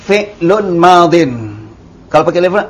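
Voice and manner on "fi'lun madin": fi'lun madin said slowly with the nunation clear; careful model reading of fi'lun madin; correct reading. Shown as a demonstration. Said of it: "Tidak boleh baca al-fi'lul madiyu".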